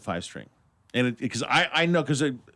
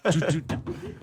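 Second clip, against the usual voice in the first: mock drum count; 2 semitones higher, slower than usual. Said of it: "it's always like the".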